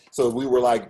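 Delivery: usual speaking voice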